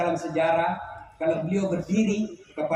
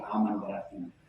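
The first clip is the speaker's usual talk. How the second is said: much lower and much softer, to himself; inner thought; 13 semitones lower, 9 dB quieter than usual. Acted. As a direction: drop the voice low, a private thought. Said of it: "Kata suami dalam hati". (suara batin, pelan dan rendah)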